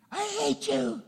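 put-on high, bratty child's cry of hate; angry child. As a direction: throw a kid's tantrum, way up high. Child voiced defying parent.

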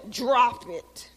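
acted-out pet command; mock dog command. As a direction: imitate an owner giving a command. Imitates owner commanding the dog.

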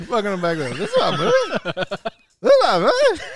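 quick, laughing host intro ending high. Pretend. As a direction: giggle through the show opening. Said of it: "trying the intro".

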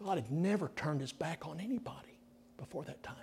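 soft whispered awe; hushed whisper. Real, not acted. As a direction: drop to a whisper to draw them in. Awed sermon climax dropped low.

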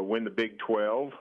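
ordinary talk, nothing marked

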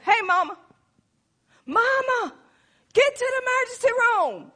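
put-on high, urgent young son voice; a worried boy begging his mother; son's voice. Son Jeremy urging mother.

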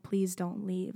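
normal talking voice

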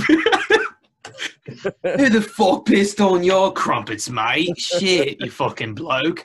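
a hammed-up angry London bloke; mock British accent. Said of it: "a far better accent than Derek".

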